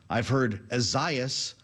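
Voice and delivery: Isaias said slowly as uh-ZYE-us; variant pronunciation. Shown as a demonstration. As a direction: open Isaias on a weak "uh", stress ZYE. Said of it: "I've heard four different pronouncers on this storm".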